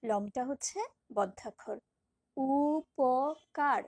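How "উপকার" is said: উপকার with উ and প drawn out very long and louder; slow, held-out উপকার; stretched syllables. Shown as a demonstration. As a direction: drag each open syllable. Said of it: "টানতে পারছি মুক্তাক্ষর".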